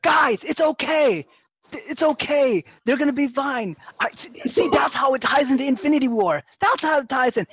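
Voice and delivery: high, loud mock lecture to the room; know-it-all guy. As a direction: play the annoying fan explaining the plot. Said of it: "he's just like".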